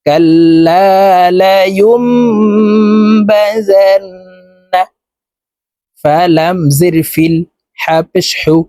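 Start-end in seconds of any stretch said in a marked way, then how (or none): 0.1-4.0 slow, high, melodic tilawat; Quran recitation. Shown as a demonstration. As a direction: chant it slowly with tajweed. (ধীর সুরেলা কুরআন তিলাওয়াত)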